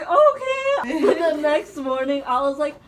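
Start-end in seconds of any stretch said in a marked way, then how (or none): 0.0-1.0 startled high okay; squeaky unsure okay; awkward high okay. Retold or imitated. Retold awkward response to hug.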